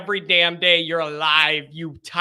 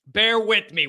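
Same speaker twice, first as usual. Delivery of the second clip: usual speaking voice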